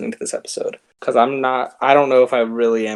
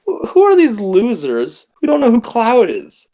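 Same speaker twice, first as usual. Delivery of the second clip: higher, incredulous outburst; indignant disbelief; 10 semitones higher, 4 dB louder than usual. Retold or imitated. Podcaster scorning friends who don't know the word, indignant. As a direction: scoff at the ignorant, pitched up. Indignant retort in retold story.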